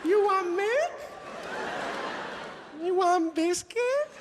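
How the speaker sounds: very high, hammed-up Chinese accent; mock Chinese accent. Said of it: "just happens to say".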